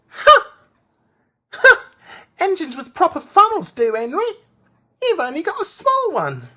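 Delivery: shrill loud Ha Ha then high cheeky taunt; Percy retorting. A narrator's reading. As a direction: crow back at Henry, very high and loud. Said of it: "But Percy answered".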